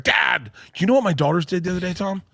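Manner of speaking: put-on high, far-off girlish voice of the daughter; mock teen daughter asking dad; daughter's voice.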